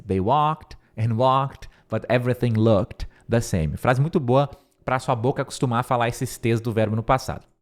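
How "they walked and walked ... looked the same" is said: very slow model sentence for students; slowly, word by word, so they can repeat; slow repeat-after-me. Shown as a demonstration. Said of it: "tenta repetir essa frase".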